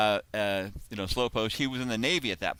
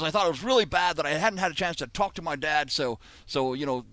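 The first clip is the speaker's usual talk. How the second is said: raised-pitch mock Australian; bad Crocodile Dundee accent; 6 semitones higher than usual. [name] finishes his story on the phone, bad Australian accent. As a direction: wind up the yarn in badly faked Aussie. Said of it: "this is my very bad Crocodile Dundee impression".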